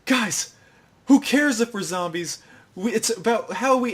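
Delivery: high-pitched mock pep talk; rally the group in a hammed-up high voice; eager imitation of an inspired speech; rousing speech. Sakura gives a rousing speech.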